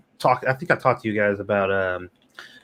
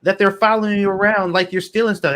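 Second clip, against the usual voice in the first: exasperated retold outburst, higher and louder; retold exasperation; 11 semitones higher, 4 dB louder than usual. Retelling scolding his friend who stole, mock outraged. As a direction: play back your own incredulous rant at a thieving friend. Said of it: "I'm just like".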